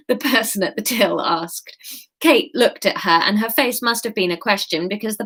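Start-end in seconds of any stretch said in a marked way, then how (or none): none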